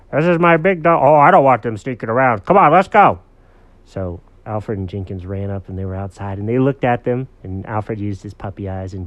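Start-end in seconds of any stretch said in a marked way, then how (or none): 0.1-3.2 louder, higher storybook dog; lightly voiced dog, in a hurry; urgent dog character. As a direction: urge your buddy along. Dog urges buddy to go.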